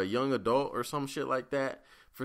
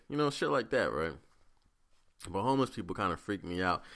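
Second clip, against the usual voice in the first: usual speaking voice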